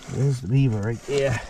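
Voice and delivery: usual speaking voice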